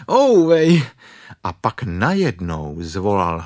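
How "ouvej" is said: loud, high drawn-out ouch; cry of pain. Acted. Bear pulled once more by friends, loud pained cry. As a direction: holler it out in pain. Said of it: "a zase".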